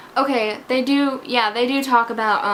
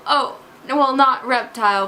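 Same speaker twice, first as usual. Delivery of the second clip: ordinary talk, nothing marked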